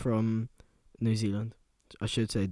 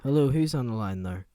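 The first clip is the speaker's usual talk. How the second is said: radio host; 4 semitones lower than usual. Acted. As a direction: hush it like a late-night DJ.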